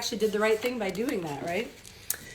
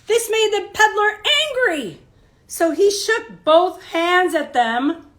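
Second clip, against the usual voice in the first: bright, louder storybook narration; reading to children; 8 semitones higher, 7 dB louder than usual. A narrator's reading. Teacher reading a picture book to children, lively storybook voice. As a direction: read it out big for the kids.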